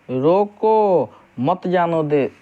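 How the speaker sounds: drawn-out "रोको", break, then "मत जानो दे" together; slow "रोको", pause before "मत जानो दे"; pause after रोको. Shown as a demonstration. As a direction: hold "रोको", stop, then one phrase "मत जानो दे". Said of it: "रोक लेना है".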